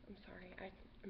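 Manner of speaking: breathy hushed sorry under the breath; whispered apology. Real, not acted. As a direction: murmur the apology almost silently. Aside apology while dogs distract.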